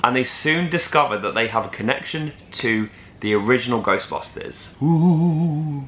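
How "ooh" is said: drawn-out, higher ghostly "ooh"; ghost wail. Acted. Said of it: "that was my imitation of a ghost".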